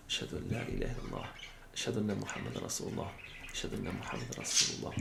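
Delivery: hushed murmur of the muezzin before the call; whispered. Shown as a demonstration. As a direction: whisper it fast under your breath. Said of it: "Then he goes on the microphone or with a loud voice".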